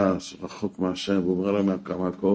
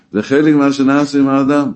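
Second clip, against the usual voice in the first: louder and higher declaration; a preacher's raised, higher climax line; preaching emphasis; 6 semitones higher, 7 dB louder than usual. Real, not acted. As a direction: drive the point home in a raised voice.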